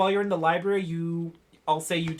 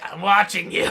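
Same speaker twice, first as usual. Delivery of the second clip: smoker voice; 3 semitones higher, 9 dB louder than usual. Acted. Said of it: "It's like".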